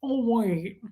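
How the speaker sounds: put-on weird villain voice; Buffalo Bill. Showing viewers her Ted Levine, creepy villain impression. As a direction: imitate a creepy movie killer's odd voice. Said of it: "I could do Ted Levine".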